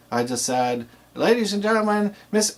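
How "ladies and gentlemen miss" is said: higher, lifted start of the announcement; light replay of the MC hype; quick reminder of the MC build-up; enthusiastic build-up. Shown as a demonstration. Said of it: "I said it in a way that's enthusiastic".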